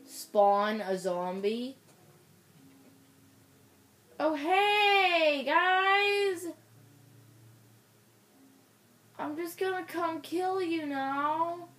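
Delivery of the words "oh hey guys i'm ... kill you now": high, drawn-out sweet taunt; playful high-pitched menace; mock-friendly singsong. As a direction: coo a greeting to your prey. Mock-friendly greeting before attacking.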